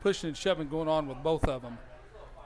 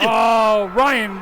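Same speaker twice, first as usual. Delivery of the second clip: loud astonished shout of the name; excited cry; 11 dB louder than usual. Real, not acted. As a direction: shout his name in delight. Reacting to saved snap.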